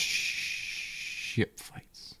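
hushed but pushed whisper; whisper yelling. Real, not acted. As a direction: whisper it urgently. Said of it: "Why are we whispering?".

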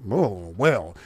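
low mocking cat-like impression of a senator; deep mocking McConnell voice. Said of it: "You got Mitch McConnell".

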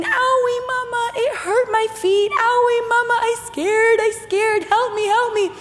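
put-on high whimpering toddler voice; hurt, scared child. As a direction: imitate a small boy wailing in pain. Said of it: "and he's going".

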